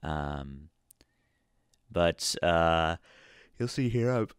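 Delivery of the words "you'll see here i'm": words cut by a yawn. Real, not acted. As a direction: start to talk, then yawn. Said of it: "Sorry, I'm yawning at you".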